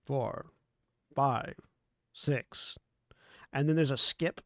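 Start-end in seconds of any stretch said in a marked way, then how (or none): 0.1-2.8 flat robotic number reading; automated voice. Acted. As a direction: count like a recorded machine. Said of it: "automated voice".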